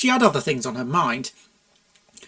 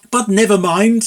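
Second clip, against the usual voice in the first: loud, falsely cheerful brush-off; forced brightness; 5 semitones higher, 8 dB louder than usual. A narrator's reading. Stallion covering an awkward moment with forced brightness. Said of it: "said the stallion with forced brightness".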